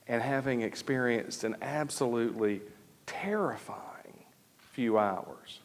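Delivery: whispered, voice dropping away; hushed whisper. Real, not acted. Preacher recalling the terrifying hours to the congregation, whispered. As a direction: breathe the words out in a whisper.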